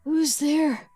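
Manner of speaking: lightly voiced weary old man; tired old whisper. A narrator's reading. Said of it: "whispers a tired old voice".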